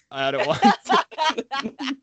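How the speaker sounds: stretched very slowly, "out at once" dragged for comic effect; slow drawn-out mock. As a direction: drag the last words out slowly, hamming it up. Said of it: "getting all of my acting".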